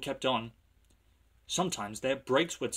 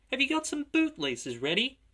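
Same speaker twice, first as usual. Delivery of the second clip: high-pitched mocking little engine; rude teasing; 13 semitones higher than usual. A narrator's reading. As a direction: taunt him in a high voice. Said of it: "laughing rudely".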